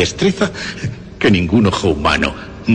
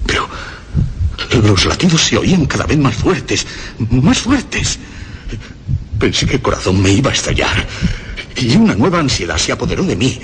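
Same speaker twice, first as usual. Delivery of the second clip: higher and faster, climbing to a shout; frantic build; 6 semitones higher than usual. Acted. Killer hears the heartbeat grow and snaps, rising to a frantic shout. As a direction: panic racing up until he bursts out.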